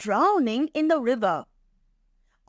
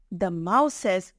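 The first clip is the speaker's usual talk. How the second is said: usual speaking voice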